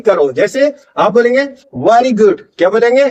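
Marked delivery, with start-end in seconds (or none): 1.8-2.4 "very good" as "wary good", lip-rounded with an "aa" vowel; wrong W for V. Shown as a demonstration. Teacher showing learners' mistake, "very good" as "vaari good". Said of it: "wrong pronunciation है".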